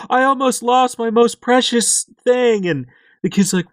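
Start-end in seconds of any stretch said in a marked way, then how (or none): 0.1-2.7 mock doting dad. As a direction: play the gruff dad suddenly gushing with love. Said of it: "then he's like".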